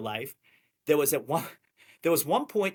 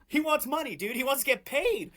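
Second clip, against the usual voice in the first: mocking voice; 11 semitones higher than usual. Mocking Ian to coworkers.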